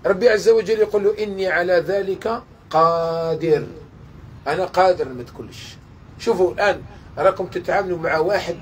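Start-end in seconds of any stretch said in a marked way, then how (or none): 1.2-5.8 slow, weighty declaration; solemn slow divine voice. Acted. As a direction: speak as an all-powerful king, unhurried. God's voice in sermon.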